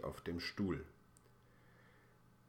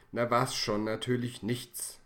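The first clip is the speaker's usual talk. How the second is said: louder, higher, irritated retort; annoyed; 5 semitones higher, 8 dB louder than usual. Acted. Annoyed father answers Ben's silly question, louder. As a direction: answer like a father at his wits' end. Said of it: "antwortet sein Vater genervt".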